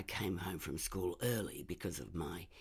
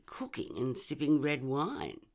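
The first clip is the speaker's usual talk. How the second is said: ordinary delivery, nothing marked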